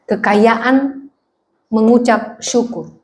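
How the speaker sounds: ordinary talk, nothing marked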